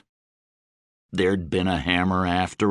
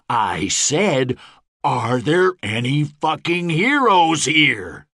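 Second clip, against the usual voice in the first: slow, building raised demand; rallying call; 5 semitones higher, 3 dB louder, slower than usual. A narrator's reading. Gabe to ten thousand mercenaries, slow building rallying call. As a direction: demand a bigger answer.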